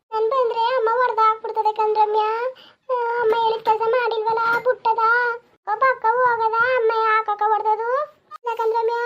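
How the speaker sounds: lightly voiced frightened girl; scared girl. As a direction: fret about going home. Frightened girls continue.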